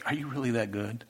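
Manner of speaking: soft, hushed, stunned question; awed, near tears. Retold or imitated. Believer weeping to God after a surprise blessing, hushed. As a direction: barely voice it, overwhelmed. You start bawling.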